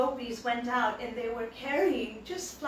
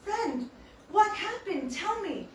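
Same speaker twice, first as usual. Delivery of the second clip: higher, worried surprise; concerned surprise; 8 semitones higher, slower than usual. Retold or imitated. Krishna asking his friend what happened, alarmed. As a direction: ask with sudden alarm. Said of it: "Krishna thought".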